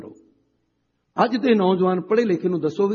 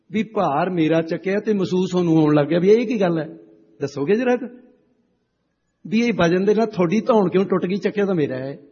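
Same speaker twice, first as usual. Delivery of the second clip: raised challenge; 4 semitones higher, 6 dB louder than usual. Retold or imitated. Retold challenge to the shopkeeper.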